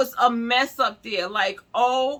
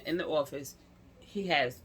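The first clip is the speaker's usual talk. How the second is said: ordinary delivery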